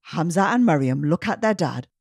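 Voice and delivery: usual speaking voice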